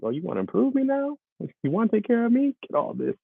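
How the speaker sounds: high-pitched, put-out talking body; indignant body. Acted. Body talks back to its out-of-shape owner, high and indignant. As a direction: challenge him in a high, affronted voice. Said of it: "Somebody like".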